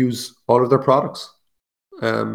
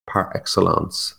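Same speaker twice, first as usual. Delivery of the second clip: Par excellence in a slow, lowered French accent; 4 semitones lower, slower than usual. Shown as a demonstration. Host repeating the phrase to enjoy his French accent. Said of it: "That's my French little accent on that".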